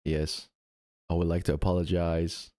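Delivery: very low, solemn guy statement; deep-voiced mock apology. Streamer playing at apologizing publicly, deep and deadened.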